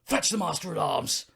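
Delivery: hammed-up call for help; boat crewman impression. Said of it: "I can do this one".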